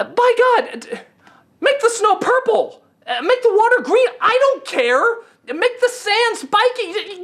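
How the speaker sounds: high-pitched exasperated ranting; a fed-up critic yelling advice at Nolan; incredulous rant. Real, not acted. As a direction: plead with the director in disbelief. Ranting at Nolan's lack of imagination.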